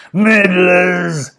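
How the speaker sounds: angry villain yell; furious shout. A narrator's reading. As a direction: spit it out in fury. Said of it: "shouted Mister Gum in fury".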